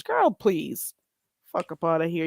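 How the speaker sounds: ordinary talk, nothing marked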